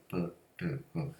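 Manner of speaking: 브, 드, 그 quietly popped as bursts; 브, 드, 그 each released with a small puff; soft plosive demo. Shown as a demonstration. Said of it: "이 펑펑펑 터져".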